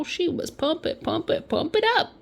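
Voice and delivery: high-pitched playful chant; hyped up. Acted. Storyteller riffing on Nicole's adrenaline, playful hyped chant. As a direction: hype it up in a high voice.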